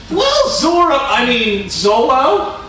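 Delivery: high-pitched mock cartoon character; cartoon dub voice. Gamer mocking an old anime dub, high cartoon voice.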